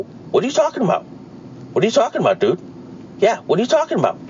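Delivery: high, fast mock bewilderment; mocking confused youth. Host mocking young people's blank reply, high fast mock confusion. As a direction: squeak it out like a baffled kid. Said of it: "They look at you and go".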